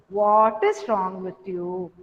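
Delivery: low, drawn-out question; very slow, low-pitched questioning tone; another tone. Shown as a demonstration. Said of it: "this tone also gives".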